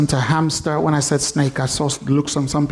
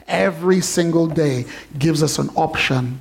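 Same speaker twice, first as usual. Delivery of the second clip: normal talking voice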